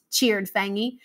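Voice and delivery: ordinary delivery, nothing marked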